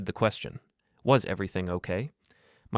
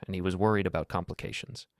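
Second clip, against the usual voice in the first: ordinary delivery, nothing marked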